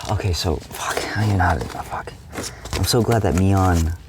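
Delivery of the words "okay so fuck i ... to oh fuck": hushed unvoiced whisper, half to herself; real whisper. Real, not acted. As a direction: whisper it, still shaken. Just woke, recounting dream.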